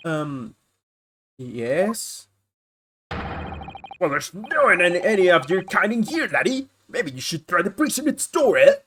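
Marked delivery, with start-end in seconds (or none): 3.1-8.8 a rough character voice with a lilt; gruff guard voice. Acted. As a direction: growl it like a surly jailer. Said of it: "I found my voice for this character".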